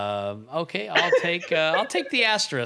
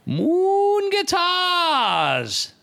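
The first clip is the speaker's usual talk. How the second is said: drawn-out announcer shout; 4 dB louder, much slower than usual. Acted. Shouting sponsor names.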